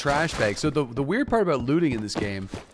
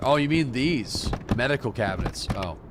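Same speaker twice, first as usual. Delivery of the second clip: usual speaking voice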